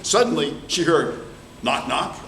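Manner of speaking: ordinary delivery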